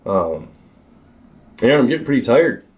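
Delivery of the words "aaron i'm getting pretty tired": higher-pitched mock call to a friend; half-hearted yell across the water; retold calling back. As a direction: call back, a little tired, without really yelling. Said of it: "I yell back to you".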